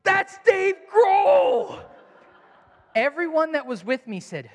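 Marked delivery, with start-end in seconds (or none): 0.0-1.8 high, loud, drawn-out shout; starstruck, yell it across the crosswalk; thrilled fan shouting; excited exclaim. Retold or imitated. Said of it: "I exclaimed".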